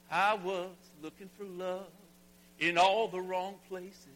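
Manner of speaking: Nashville-style country twang on the chorus. Shown as a demonstration. Pastor singing the song's chorus to his congregation in country twang. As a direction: croon it like a country singer. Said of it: "get on my little Nashville groove here".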